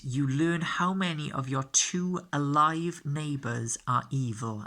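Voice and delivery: slow down and hit "alive" and "evil"; stress on alive and evil. Shown as a demonstration. Teacher explaining the empath to players, stressing "alive" and "evil". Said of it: "And I'll stress the words alive, and I'll stress the word evil".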